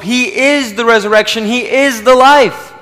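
rising preacher's proclamation; proclaiming. Real, not acted. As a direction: declare it from the pulpit, building to the final word. Preacher proclaiming Christ's identity.

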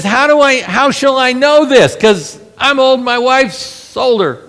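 hammed-up Zechariah questioning the angel; whiny doubting old man. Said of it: "he says".

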